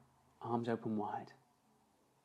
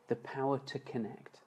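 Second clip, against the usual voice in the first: ordinary delivery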